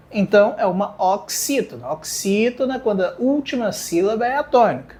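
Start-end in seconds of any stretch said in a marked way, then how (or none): none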